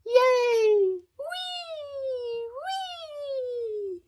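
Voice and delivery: high squealing cheers of delight; children cheering. Acted. Children racing into the gym, squealing with delight. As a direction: shriek with joy like kids at play.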